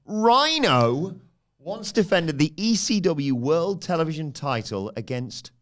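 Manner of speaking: big game-show announcer read; quiz host voice. Acted. Quiz host reading question.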